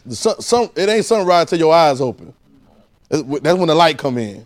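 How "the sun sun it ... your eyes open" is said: ordinary talk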